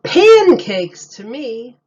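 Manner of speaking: a booming punchline; loud reveal. A narrator's reading. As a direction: shout the answer triumphantly.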